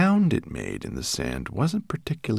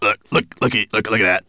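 higher, louder stuttered warning; croaked alarm; 4 semitones higher, 6 dB louder, much faster than usual. A narrator's reading. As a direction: stumble over it in panic. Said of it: "he croaked".

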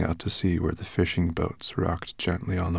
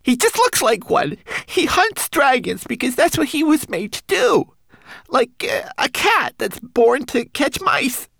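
high, small homunculus voice; a tiny frightened creature warning; 6 dB louder than usual. Acted. Homunculus Twigleg's voice.

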